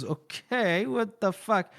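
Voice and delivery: drawn-out okay, rising pitch; lightly acted puzzled reaction; mock bewilderment. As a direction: play baffled disbelief. Retold bewildered reaction to panic.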